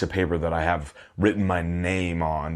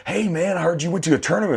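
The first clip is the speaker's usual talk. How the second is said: high, fast, chummy greeting; dad's cheery friend; 11 semitones higher, faster than usual. Retold or imitated. Dad's friend asking about the tournament, high and eager. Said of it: "one of my dad's friends is like".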